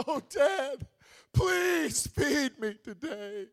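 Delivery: high, slow, loud pleading whine; begging child. Retold or imitated. Child at the store begging dad not to leave, slow loud wail. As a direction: wail like a kid scared of being left behind. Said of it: "your child walks up to you and says".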